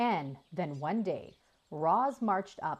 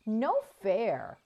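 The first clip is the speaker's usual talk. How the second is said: whine; 4 semitones higher, 4 dB louder than usual. A narrator's reading. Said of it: "Jaya whined".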